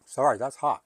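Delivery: mouth full. Real, not acted. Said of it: "excuse me for talking with my mouth full".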